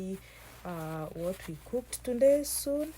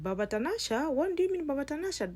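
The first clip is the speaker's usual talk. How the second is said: shocked disbelief; 7 semitones higher, 3 dB louder, faster than usual. Acted. Sister stunned by "father of Tanasha.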